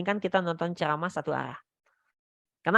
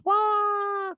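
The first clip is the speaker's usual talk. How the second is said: mock tantrum yell, much higher; imitated toddler scream. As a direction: play the child shrieking mid-tantrum. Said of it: "dia teriak lagi". (meniru teriakan anak tantrum)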